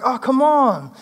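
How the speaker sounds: mock-frustrated teacher pleading they almost get it; exasperated Jesus. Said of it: "Jesus' frustration with these followers".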